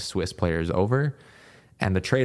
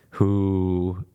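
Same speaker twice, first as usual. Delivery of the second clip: ordinary talk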